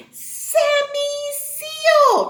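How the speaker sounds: high, loud, very drawn-out announcement; excited reveal. Pretend. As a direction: stretch the name out high and loud. Revealing a new character friend.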